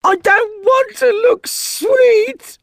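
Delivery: high loud slow wail; howling. Acted. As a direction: howl in protest. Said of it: "howled Ross".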